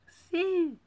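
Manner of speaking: high squeaky yes. Acted. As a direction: squeak it like a cartoon. Squeaky silly interjection.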